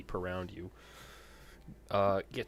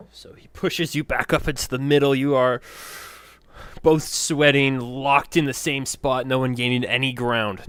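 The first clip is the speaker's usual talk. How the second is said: loud, raised-pitch play-by-play; game master narration; 5 semitones higher, 12 dB louder than usual. A narrator's reading. Game master calling a deadlocked arm-wrestle, loud intense narration. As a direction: build up the deadlock at full voice.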